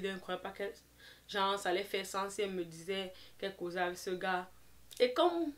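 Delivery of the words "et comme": laughing. Real, not acted. Laughing before retelling.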